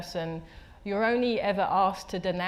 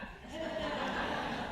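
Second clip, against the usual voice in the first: 1 airy laugh; 7 semitones higher, 9 dB quieter than usual. Real, not acted. Laughs after the quote.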